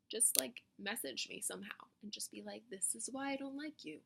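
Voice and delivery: quiet aside. Pretend. Suggesting how haters could message her.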